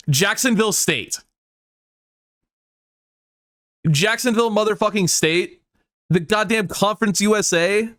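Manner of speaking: high, incredulous yelling at the screen; real disbelief, voice way up and ranting; furious outburst. Real, not acted. Gamer snubbed by the playoff, yelling in disbelief. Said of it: "I am actually fucking annoyed".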